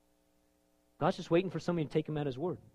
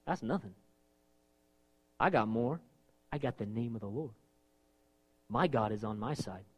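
calm assurance; 6 semitones lower, 6 dB quieter than usual. Retold or imitated. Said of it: "He looks at that and says".

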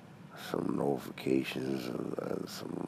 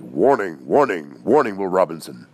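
louder robot alarm voice, repeating the warning; 2 semitones higher, 9 dB louder than usual. Retold or imitated. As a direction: call out like the Lost in Space robot. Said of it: "the robot and Will".

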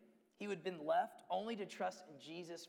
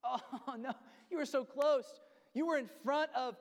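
higher, louder, laughing disbelief; laughing lament; 7 semitones higher, 6 dB louder than usual. Real, not acted. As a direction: groan with a laugh at how close he came. Preacher laments the near miss.

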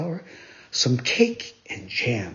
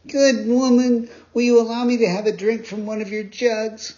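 put-on higher, louder boyish plea; thirsty puppet boy; 8 semitones higher, 4 dB louder than usual. A narrator's reading. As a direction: ask sweetly for a drink. Pinocchio asking for water.

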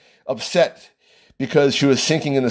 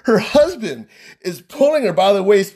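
usual speaking voice

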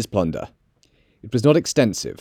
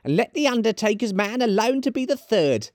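very high-pitched woman's character voice; high, forward charwoman; bold charwoman; 11 semitones higher than usual. Acted. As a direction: pipe up high, addressing the company.

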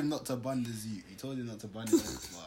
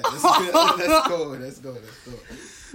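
hype shout; 7 semitones higher, 18 dB louder than usual. Real, not acted. Hyping up the podcast start.

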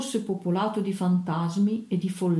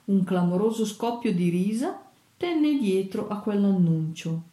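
ordinary delivery, nothing marked

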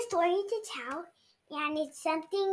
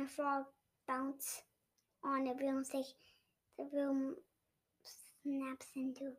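unvoiced, hushed whisper; 3 semitones lower, 10 dB quieter than usual. Real, not acted. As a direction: whisper the scary ending. Child whispers the scary part.

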